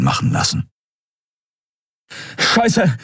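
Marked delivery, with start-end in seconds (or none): 2.4-3.0 high-pitched exclaimed curse; sudden cry. A narrator's reading. Fighter finds his weapon failing, sudden cry. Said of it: "rief Kai plötzlich aus".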